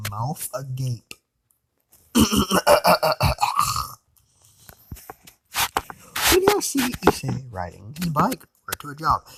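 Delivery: ordinary delivery, nothing marked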